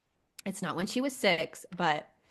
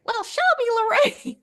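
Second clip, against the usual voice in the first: put-on much higher, louder little child's voice; little girl voice; 8 dB louder than usual. Mom imitates her little daughter's cute excuse, high child voice. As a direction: squeak it like a tiny Southern girl. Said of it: "says in her little voice".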